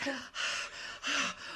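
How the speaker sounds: exaggerated breathless gasp; hammed-up actor's gasp for air; mock gasp. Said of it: "They are so out of breath".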